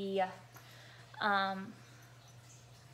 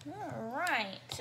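ordinary talk

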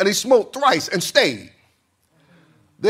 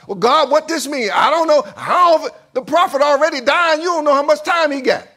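loud, high flustered questioning; flustered doubter; 9 semitones higher, 6 dB louder than usual. Acted. King questioning God as the prophet is dying, flustered. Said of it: "starts stuttering like some of us".

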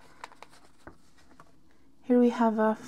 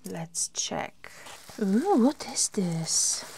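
whispering; 3 semitones lower, 6 dB quieter, slower than usual. Real, not acted. Unboxing, hushed curiosity.

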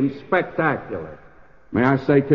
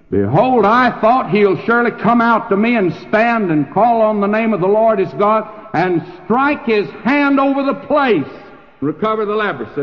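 higher, louder outraged complaint; indignant general; 6 semitones higher, 3 dB louder than usual. Acted. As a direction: huff like a slighted general. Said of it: "Believe me, he was angry".

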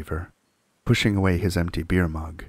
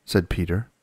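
ordinary delivery, nothing marked

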